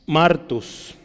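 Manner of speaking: "martus" said slowly, stressed on the first syllable, MAR-tus; acento en la A. Shown as a demonstration. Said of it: "Hay un acento en la A". ("martus" despacio, con acento en la A)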